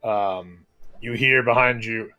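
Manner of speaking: normal talking voice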